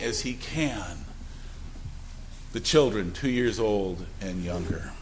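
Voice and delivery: low, soft and grave; solemn lament with long pauses; somber, hushed preaching. Real, not acted. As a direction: speak quietly of grief, letting phrases sink. Preacher grieves over war deaths.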